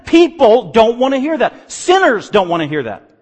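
loud, rising preacher's cry; fiery preaching. Acted. Pastor pressing the Corinthians on sin, loud preaching. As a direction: hammer the gospel home to the congregation.

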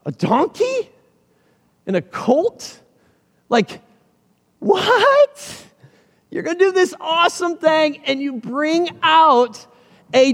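disbelieving squeak on donkey, slow, drawn-out repeat; incredulous disciple. Retold or imitated. Disciple to Jesus, baffled by the donkey request, incredulous. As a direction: play the baffled disciple who can't believe it.

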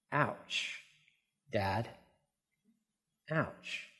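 very soft, with long pauses; quiet hurt. Retold or imitated. Son hears father call Benjamin the only one, very quiet hurt. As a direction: let the words drop almost to nothing. Wounded reaction to father's slight.

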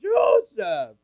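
high, loud cry of the name; Anna exclaiming. Acted. Anna recognises young Joseph, crying out high and loud. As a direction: call it out in sudden delight. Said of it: "she said".

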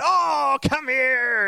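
high, gushing welcome; affectionate coo, pitched well up; doting grandma. Retold or imitated. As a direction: imitate a grandma sweeping someone into a hug. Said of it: "but she just went".

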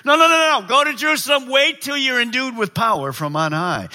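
high, emphatic interruption, starting loud; urgent restraining command. Acted. Preacher as Jesus telling disciples to wait, urgent. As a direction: stop them before they rush off.

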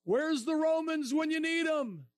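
high, slow mocking taunt; heckler taunt. Heckler taunting the preacher about the Romans, mocking. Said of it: "Then he goes".

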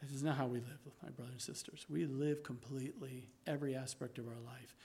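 soft, low, intimate sermon voice; hushed preaching. Acted. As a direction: draw them in close and quiet.

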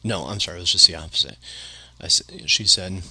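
normal talking voice

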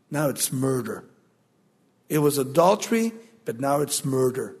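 low, soft and heavy on murder; grave verdict. Acted. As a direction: drop the voice for murder.

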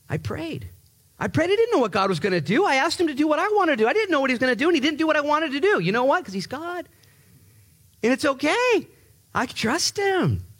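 high, fervent testimony; passionate David. Retold or imitated. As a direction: pour out trust with fire.

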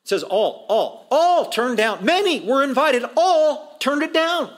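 "all" stretched long, high and loud; high, drawn-out emphasis on "all"; exaggerated emphasis. Shown as a demonstration. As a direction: punch and drag out every "all". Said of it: "He's exaggerating".

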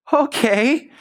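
put-on high-pitched, doubtful child; unsure ten-year-old. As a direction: go along with it uncertainly, as a kid. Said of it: "there's a ten-year-old like".

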